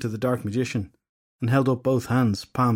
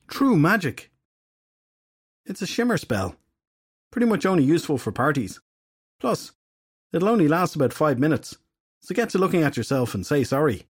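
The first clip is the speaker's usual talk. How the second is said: higher, breezy and pleased with himself; cocky fairy detective; 5 semitones higher than usual. Acted. Filthy Henry showing off his spell to Edgar, breezy and smug. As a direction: toss it off like a party trick.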